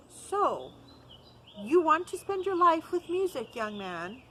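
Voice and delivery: lightly voiced master, pressing hard; probing old master. Master asks the boy if he wants music, probing.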